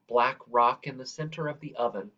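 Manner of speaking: plain reading voice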